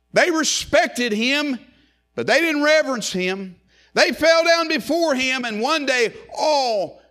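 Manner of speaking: climbing pulpit fervour; fervent preaching. Acted. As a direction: lift the congregation with rising fervour. Preaching at full force.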